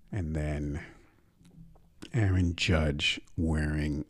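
normal talking voice

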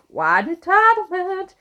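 high, slow, drawn-out sing-song; exaggerated snooty mockery; mock entitlement. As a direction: stretch it high in a put-on, mocking voice.

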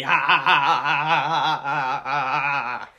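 staccato evil ha ha laugh; mad scientist laugh. Acted. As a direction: laugh like a cartoon villain. Villain laugh continues.

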